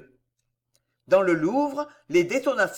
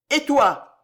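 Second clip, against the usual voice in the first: loud, high challenge; menacing demand; 6 semitones higher, 6 dB louder than usual. A narrator's reading. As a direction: round on him with a raised voice. Raging king turns on Condé.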